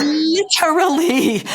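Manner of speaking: high, loud, slow stretched "Literally"; emphatic literally. Real, not acted. To her co-host, insisting on "Literally" high and slow. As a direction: lean hard on "Literally" and draw it out slowly.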